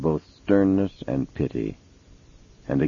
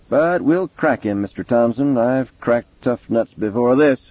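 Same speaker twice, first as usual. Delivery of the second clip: higher, gruff cop voice; gruff police chief voice; 6 semitones higher than usual. Acted. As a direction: play the impatient detective. Mason boasts, slowly.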